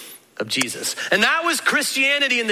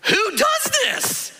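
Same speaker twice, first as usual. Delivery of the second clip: incredulous outcry; 12 semitones higher than usual. Retold or imitated. Crowd protesting to Jesus, a high disbelieving cry. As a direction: cry out in disbelief, dragging the words. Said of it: "people are all left sitting there going".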